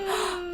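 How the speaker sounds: sharp gasp of disbelief; shocked gasp. Retold or imitated. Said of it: "and I was like".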